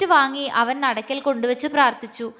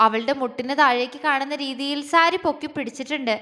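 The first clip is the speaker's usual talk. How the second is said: ordinary delivery, nothing marked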